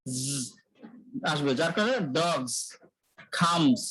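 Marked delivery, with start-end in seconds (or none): 0.1-0.2 জ as a long buzzing "zzz"; isolated buzzes of জ, pauses between; demonstrating Z. Shown as a demonstration. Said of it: "উচ্চারণটা কি হবে". 2.2-3.8 ডগস and খামস ending in a buzzed Z; careful single words, ডগস and খামস, with "zz" endings; demonstrating final Z. Shown as a demonstration. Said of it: "এটা জ আসছে sound".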